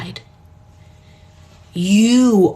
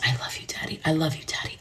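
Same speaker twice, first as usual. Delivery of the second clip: hushed whisper, softer, barely any voice; whispering to Daddy; 11 dB quieter, much faster than usual. Retold or imitated. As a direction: whisper it as if only he can hear. Silently sending love to father.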